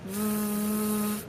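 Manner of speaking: soft, long voiced vvv buzz; sustained "V" buzz, throat vibrating; buzzing V sound. Shown as a demonstration. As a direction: hold "V" as a steady voiced buzz. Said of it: "Try producing the sound of the letter V repeatedly".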